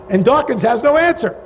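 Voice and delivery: ordinary delivery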